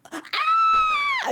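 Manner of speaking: very high, loud demo shriek. Retold or imitated. Player showing how they watched the event, shrieking. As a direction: let out a piercing imitation scream. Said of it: "just shrieking and screaming".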